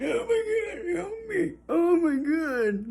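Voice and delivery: very high, wailing plea for help; mock suffering. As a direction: whimper it in a thin high voice, hammed-up. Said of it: "while we're suffering".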